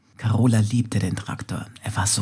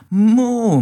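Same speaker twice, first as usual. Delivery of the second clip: loud, much higher delighted moo; joyful moo; 11 semitones higher, 8 dB louder than usual. Acted. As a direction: moo for joy in the wind. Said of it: "Das war toll". (begeistertes, lautes, hohes Muhen)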